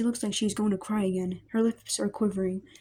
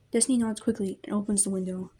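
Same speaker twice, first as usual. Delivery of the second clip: normal talking voice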